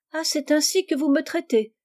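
high-pitched narrated indignation; indignant. A narrator's reading. Girl overhearing her cousins' remarks, indignant. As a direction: take offence, voice lifted well up. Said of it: "se dit-elle avec indignation".

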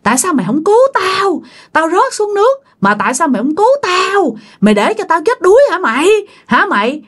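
high, eerie accusing wail; wailing ghost. Acted. Old ghost woman lunging at the dreamer, high wailing accusation. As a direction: howl the blame like a drowned hag. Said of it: "vừa lôi vừa gào vừa chửi".

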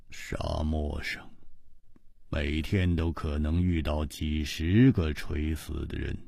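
low, soft, slow and cold; slow, quiet, flat-hearted warning; slowly. A narrator's reading. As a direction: lay it out slowly, unmoved. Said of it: "季冰燕缓缓地说".

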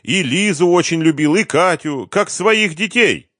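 high, loud, pleading voice; desperate pleading. A narrator's reading. Cornered man pleads with his accuser.

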